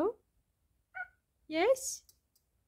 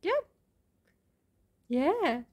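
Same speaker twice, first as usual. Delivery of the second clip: normal talking voice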